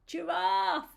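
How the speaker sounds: high sung-out announcement; excited reveal. Acted. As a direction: announce the animal brightly to children. Revealing the next animal.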